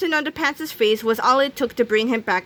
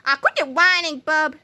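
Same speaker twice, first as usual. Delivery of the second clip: higher-pitched dismissive retort; scolding; 6 semitones higher than usual. A narrator's reading. George scolds whining Melvin, dismissive. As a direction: brush off the crybaby.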